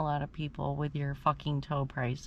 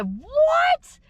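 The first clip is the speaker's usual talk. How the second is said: much higher, loud disbelieving shriek; shocked outburst; 11 dB louder, much slower than usual. Retold or imitated. Hearing the cash price, a loud shrieking disbelief. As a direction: screech it in total disbelief. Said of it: "I said".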